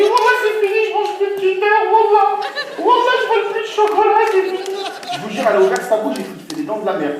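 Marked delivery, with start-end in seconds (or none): 0.0-4.6 very high, loud panicky vow; panicked swearing. Acted. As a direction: swear off chocolate in terror. Jure paniqué, très aigu. (serment paniqué, très aigu et fort)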